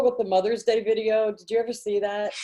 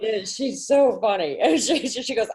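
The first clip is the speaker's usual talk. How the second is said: usual speaking voice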